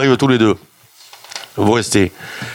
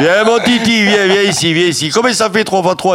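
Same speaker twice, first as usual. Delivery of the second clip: higher, sweet coaxing call; 7 semitones higher than usual. Retold or imitated. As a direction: coax him like a pet. Director coaxing third patient.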